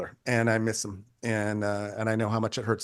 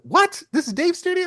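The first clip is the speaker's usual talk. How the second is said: high-pitched, incredulous questioning; acted-out amazed double take; astonished; 5 dB louder than usual. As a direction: react as if floored by the news. Retells learning whose studio it is.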